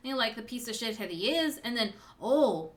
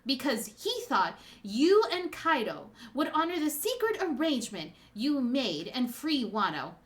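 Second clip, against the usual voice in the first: impassioned speech, building louder toward the end; passionate; 5 dB louder than usual. Acted. Daughter recounting father's suffering to his betrayer, passionate. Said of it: "You were very passionate there".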